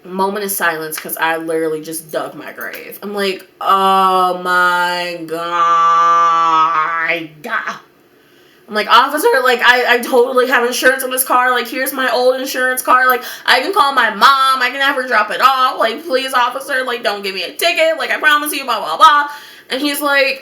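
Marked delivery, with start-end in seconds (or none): 3.6-7.7 extremely slow, stretched-out groan; drawn-out dread. Retold or imitated. Realises the card is at home. 8.9-19.3 high, rushed-sounding mock begging; frantic pleading. Begging officer not to ticket.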